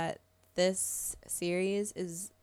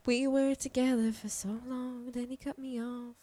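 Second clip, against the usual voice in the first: slow, higher mock-sad voice; sad dreads; 4 semitones higher, 4 dB quieter than usual. Friend voices the abandoned dreads, mock-sad. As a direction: tell a mock sob story slowly. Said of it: "telling me the sad story".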